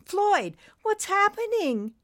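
high, frightened little voice; scared for his friend. Acted. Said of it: "He was scared".

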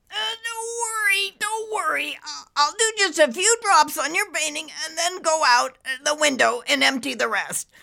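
high, squeezed voice; nervous cartoon cloud under pressure; strained cloud reassuring. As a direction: reassure while barely holding it in.

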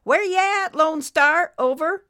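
high, loud radio call. A narrator's reading. Nurse hails the sick trucker by radio, calling out. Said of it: "Nurse Betty called out again".

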